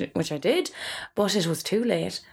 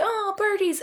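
high-pitched delighted coo; cooing old folk; 12 semitones higher than usual. Retold or imitated. Old people spotting the budgies, cooing. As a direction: coo at the birdies in mock delight.

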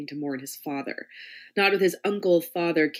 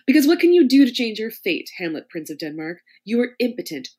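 louder, mocking mistress; taunting domme; 5 semitones higher, 6 dB louder than usual. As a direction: belittle him with relish. Lady M humiliates Hamlet.

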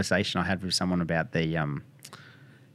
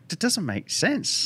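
much higher, slow and drawn out; emphatic disbelief; 12 semitones higher, much slower than usual. Real, not acted. Arguing chronic pain patients deserve better, slow exasperated emphasis. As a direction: stretch it out in exasperation. Frustration at drug side effects.

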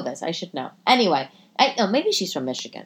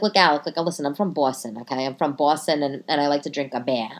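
mock Boston accent; 6 semitones lower than usual. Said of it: "however the fuck they said".

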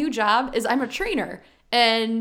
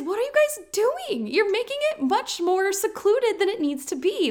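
high-pitched exasperated appeal; exasperated plea; 7 semitones higher than usual. Acted. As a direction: plead with the critics, voice climbing high. Exasperated imagined plea to online critics.

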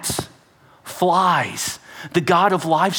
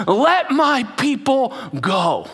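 higher, slow, solemn proclamation; Moses commanding; 8 semitones higher, slower than usual. Acted. Moses pointing the staff at Pharaoh, slow solemn proclamation. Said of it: "he points it and goes".

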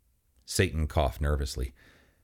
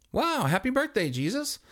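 pitched way up in surprise; high surprised voice. Acted. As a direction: squeak it out, amazed. Chris surprised by Jesus.

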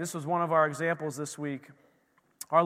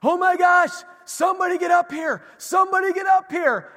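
high, loud panicked shouting upstairs; calling everyone urgently, high and loud; frantic yell; 10 semitones higher, 6 dB louder than usual. Retold or imitated. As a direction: imitate a mom yelling for help. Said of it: "Liz starts yelling".

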